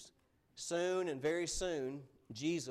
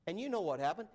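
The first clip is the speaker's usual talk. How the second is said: ordinary delivery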